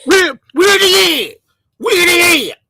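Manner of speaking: hamming up how they talk; mocking imitation. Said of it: "That's how these guys talk".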